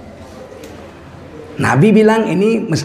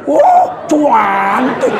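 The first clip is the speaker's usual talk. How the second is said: slow drawn-out Wah, cantik; Javanese admiration; 4 semitones higher, much slower than usual. Retold or imitated. Preacher imitating a Javanese husband praising his wife. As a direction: savour Wah, cantik slowly with a laugh. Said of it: "Kalau istrimu cantik".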